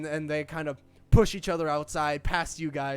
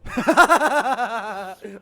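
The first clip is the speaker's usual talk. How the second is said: loud burst of laughter; 7 semitones higher, 13 dB louder than usual. Real, not acted.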